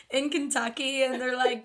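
ordinary talk, nothing marked

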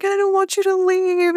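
put-on high pleading little girl voice; crying child. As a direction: plead like a small crying kid.

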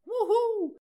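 very high, loud falling wuhuu cheer; whoop. Acted. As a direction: cheer like a fan at a concert. Cheer after each patron thanked.

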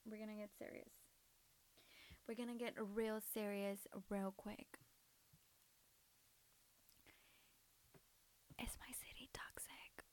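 breathy secretive whisper; ASMR whisper. Shown as a demonstration. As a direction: lean in and whisper it close. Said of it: "Was that A S M R".

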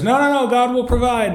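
high-pitched comic refusal; mock stubborn refusal. Man on the roof refusing the helicopter, mock stubborn.

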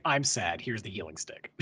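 a mopey machine handing it over; mock sad appliance. Sad house AI giving Luke the healing stick, low mock-mope. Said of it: "And he's like".

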